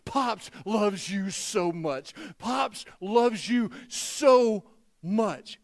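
slow, weighted "so much"; emphatic affection. Retold or imitated. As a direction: draw out "so", pause before "much". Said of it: "a little extra emphasis to it".

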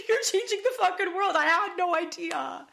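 high, quick, laughing through tears; awed and tearful, half laughing; overcome with emotion. Real, not acted. Host tells guest she's changing the world, emotionally overwhelmed.

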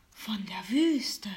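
mysterious slow whisper; fortune teller. Acted. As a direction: whisper it like a secret.

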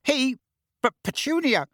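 high, halting objection; flustered protest. Acted. Uncle objecting to his wife, flustered. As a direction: splutter a protest.

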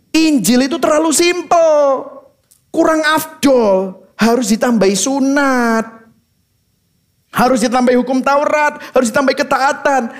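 high, mocking lay-it-on voice; sneering parody of the legalists. Preacher mocks legalists, high sarcastic parody.

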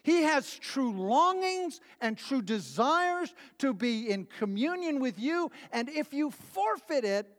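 slow, high-pitched fervent preaching. Acted. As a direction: preach it with rising fervor. Preaching at climax.

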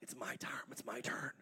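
whispered mock sulk; the Father pretending jealousy, whispered; jealous whisper. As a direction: hiss a petty jealous complaint softly. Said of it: "The Father's not in heaven going".

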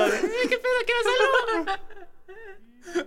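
very high, slow squeaky little voice; squeaky sperm voice. Pretend. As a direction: squeak like a tiny swimming sperm.

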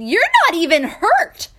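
loud, high cry of astonishment; astounded king's exclamation; amazed shout. Acted. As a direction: shout it in joyful disbelief. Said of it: "Amazed, the king shouted".

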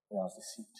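breathed out in a whisper; hushed whisper. Acted. Preacher reading Jeremiah's lament to the church, whispered. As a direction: let the line drop to a bare whisper.

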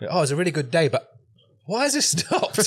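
cheery start climbing into a mock high squeal; mock exasperation. Imitating someone as his video stops, mock dismay.

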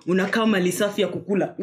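usual speaking voice

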